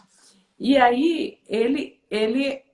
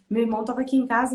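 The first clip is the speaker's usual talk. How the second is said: usual speaking voice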